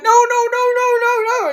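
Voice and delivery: high, loud frightened no, no, no; panicked refusal. Retold or imitated. Colleague hears a strange man, panicked high no. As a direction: recoil in terror, voice shooting up.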